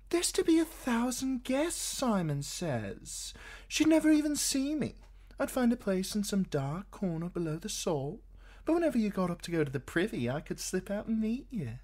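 put-on high, light young woman's voice; Shay's voice. A narrator's reading. Shay chattering to Tyrion in bed, high and eager.

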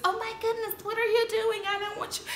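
high, breathy fake protest; mock fake protest. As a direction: protest while secretly wanting it. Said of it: "Rinna does this".

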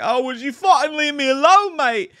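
high, loud exasperated Australian plea; Aussie exasperation. Retold or imitated. Hunted man to the killer, loud Australian exasperation. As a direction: beg in Australian, fed up. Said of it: "Ryan Coogler's character is just like".

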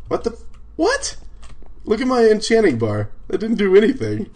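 high, incredulous complaint; baffled outburst. Real, not acted. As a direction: protest in high-pitched disbelief. Baffled by game glitch.

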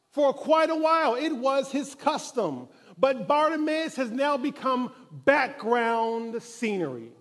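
ordinary talk, nothing marked

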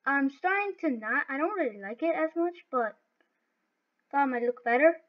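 ordinary talk, nothing marked

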